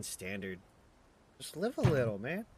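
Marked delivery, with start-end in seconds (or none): 1.4-2.5 playful urging. Acted. Friend voiced, urging.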